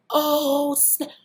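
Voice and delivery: high, loud excited stammer of a name; imitating an old friend overjoyed to see her. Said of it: "he's doing this".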